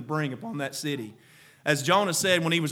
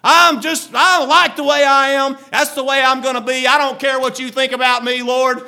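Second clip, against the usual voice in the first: higher, louder stubborn Jonah; defiant Jonah; 9 semitones higher, 11 dB louder than usual. Acted. Jonah telling the Lord he won't change, defiant. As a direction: dig in and defy, hammed-up. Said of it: "That's what Jonah said".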